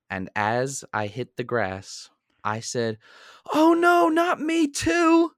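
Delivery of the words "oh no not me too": high, slow drawn-out shriek of dismay; mock shriek. Retelling his own trip, a long high shriek of dismay. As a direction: wail it like your dinner is already lost. Tripping, food about to fly.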